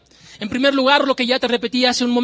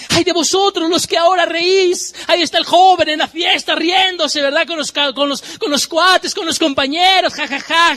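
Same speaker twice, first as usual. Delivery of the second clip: louder, higher preacher's cry of woe; preaching at full force; 8 semitones higher, 4 dB louder than usual. Acted. Preacher crying woe over those who ignore the eternal, loud and high. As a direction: warn the sinners from the pulpit.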